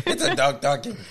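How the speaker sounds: laughing mimicry of a Portuguese accent; attempted Portuguese accent. Co-host repeating her mum's line, attempted Portuguese accent. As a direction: try a Portuguese accent while giggling. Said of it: "attempt it because it sounds offensive".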